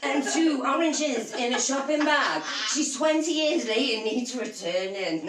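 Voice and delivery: hammed-up Scottish accent with laughter; comic Scottish appeal to the crowd; Billy Connolly. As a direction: deliver the punchline in mock Scottish. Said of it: "Scottish accent and vast love of Billy Connolly".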